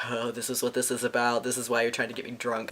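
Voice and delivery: low, hushed retold realization; quiet realization. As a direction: say it softly, as if to yourself.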